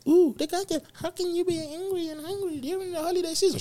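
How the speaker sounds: high squeaky mocking voice of a critic; mocking imitation. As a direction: whine it back like a know-it-all. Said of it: "What do I mean by that?".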